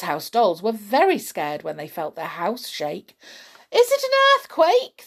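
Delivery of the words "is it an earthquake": high, loud panicked squeak; frightened cry. Acted. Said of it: "they cried".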